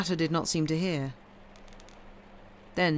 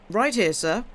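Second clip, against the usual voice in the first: louder, higher call; 5 semitones higher, 5 dB louder than usual. A narrator's reading. Driver calling out to Franz from the inn table. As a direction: sing out across the room. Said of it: "he cried".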